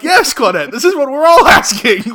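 loud, high, laughing exclamation; gleeful agreement. Acted. Viewer answers Claudette on screen, loud delighted agreement. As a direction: cheer her on, breaking into laughter.

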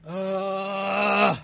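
loud groan building at the end; drawn-out real moan of suffering; long pained groan. Real, not acted. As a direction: groan through lingering pain. Groaning through the burn.

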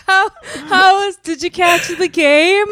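high, slow, forced chit-chat voice; strained polite small talk, laughing; awkward small talk. Acted. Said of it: "You're like".

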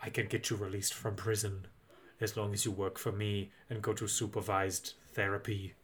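low, very soft mentor voice; Scandinavian professor. Acted. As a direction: make the offer low and quietly, like a Scandinavian professor. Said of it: "he's like".

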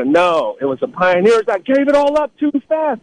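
lifted, taunting voice, pitched well up; high-pitched mock scolding. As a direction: needle them like a know-it-all, high. Now I go.

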